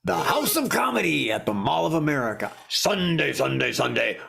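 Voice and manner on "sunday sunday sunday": mock monster-truck promo chant; announcer parody. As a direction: hype it like a radio ad.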